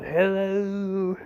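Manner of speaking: stretched, high hello; another head greeting. Acted. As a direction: pitch up for a small chiming-in voice. Last head pops out, greeting.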